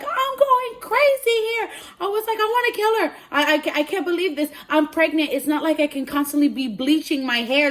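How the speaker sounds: high squeal easing into loud complaint; frantic rant. Retold or imitated. Client rants to friend about hair, frantic. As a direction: retell a meltdown, starting at a shriek.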